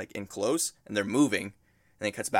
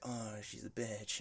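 mock upset; 16 dB quieter, slower than usual. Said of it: "and he really upset".

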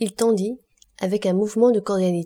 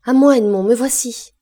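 loud, raised call to a lover; joyful cry; 5 semitones higher, 9 dB louder than usual. A narrator's reading. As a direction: cry out high and loud, rushing to the door. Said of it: "qu'elle ouvrit en s'écriant". (cri sonore et élancé)

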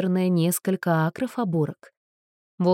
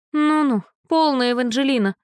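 lazy voice; 6 semitones higher, 4 dB louder than usual. A narrator's reading. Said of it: "раздался чей-то ленивый голос".

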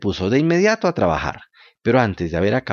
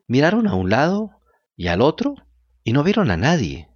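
ordinary delivery, nothing marked

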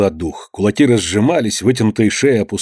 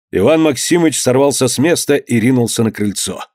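usual speaking voice

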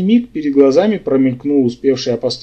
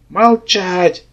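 higher-pitched narrated shout, held back in volume; raised-voice command without full force; 5 semitones higher, much slower than usual. A narrator's reading. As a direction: cry out the order to be silent. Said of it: "заорал я на всю глотку".

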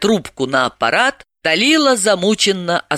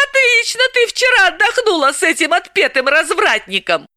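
Galina teasing. Acted. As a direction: rib her friend mercilessly.

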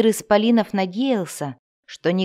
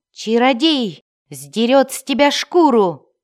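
shouted threat; 4 semitones higher, 6 dB louder than usual. A narrator's reading. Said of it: "выкрикнул".

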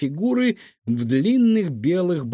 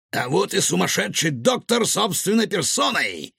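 high, self-satisfied announcement; pleased exclamation; 7 semitones higher than usual. A narrator's reading. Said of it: "довольно воскликнул Рикошет".

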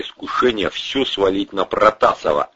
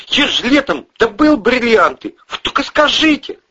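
high-pitched desperate offer; gabbled plea; 5 dB louder than usual. A narrator's reading. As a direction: plead frantically to be useful. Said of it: "затараторил Андрей".

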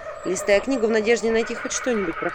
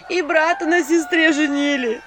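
high, loud, slowed lament; keening village woman; 9 semitones higher, 6 dB louder than usual. A narrator's reading. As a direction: wail it through the door. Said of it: "причитал голос за дверью".

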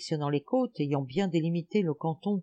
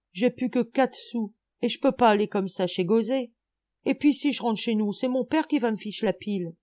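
sobbing plea; 5 semitones higher, 3 dB louder than usual. A narrator's reading. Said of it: "hachées de sanglots".

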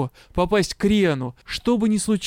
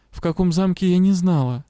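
ordinary delivery

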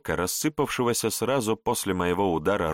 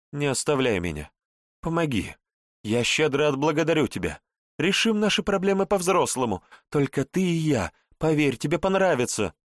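rising, wheedling plea, higher; seductive pleading; 6 semitones higher than usual. A narrator's reading. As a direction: coax him sweetly, begging. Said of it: "Как-то слишком нежно заговорила та". (соблазняющая мольба, выше тоном)

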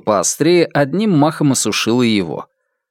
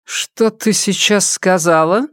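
burning glare; 7 semitones higher, slower than usual. A narrator's reading. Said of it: "упёрла в неё пылающий взор".

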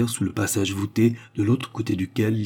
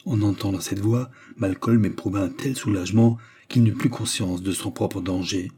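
ordinary delivery, nothing marked

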